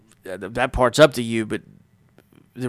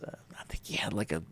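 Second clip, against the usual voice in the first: ordinary talk, nothing marked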